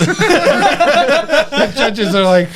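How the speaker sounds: high, slow words through a laugh. Real, not acted. Laughing hard mid-story.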